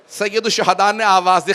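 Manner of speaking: normal talking voice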